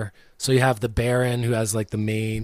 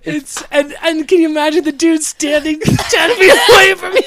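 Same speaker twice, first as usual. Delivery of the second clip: high, loud, through helpless laughter; laughing hard; 11 dB louder than usual. Real, not acted. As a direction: crack up as you say it. Cracking up telling the scene.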